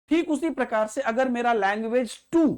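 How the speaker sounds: ordinary delivery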